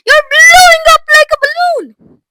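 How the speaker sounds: very high, loud shriek; horrified screech. A narrator's reading. Mother watching Violet blow up, screeching. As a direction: screech it in sheer panic. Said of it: "screeched Missus Beauregard".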